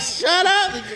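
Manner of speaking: high-pitched mock complaint; retold annoyed yell. Man retelling how he hushed his noisy friend, mock-annoyed yell. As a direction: call it out, playing up the annoyance.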